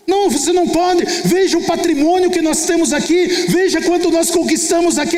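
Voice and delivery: husband forbidding. Retold or imitated. Husband forbids her leaving.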